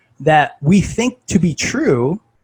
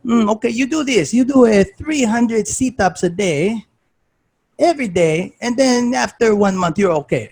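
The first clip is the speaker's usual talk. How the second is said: mock the coach's thick European accent, prescribing sit-ups; 8 semitones higher than usual. Coach brushing off the injured speaker, thick European accent. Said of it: "he's got this thick European accent".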